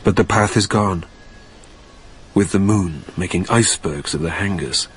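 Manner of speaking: low, hushed inner narration; story narration. A narrator's reading. Narrator trudging the airfield in a low brooding tone. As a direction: tell it low and brooding, to yourself.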